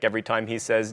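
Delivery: usual speaking voice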